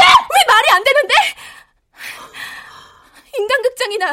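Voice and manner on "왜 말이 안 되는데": louder, higher defiant retort; defiant shout. Acted. Daughter shouts back at her mother, defiant.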